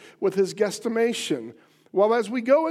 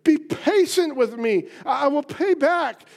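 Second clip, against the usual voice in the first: begging; 6 semitones higher, 5 dB louder than usual. Acted. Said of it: "begged him".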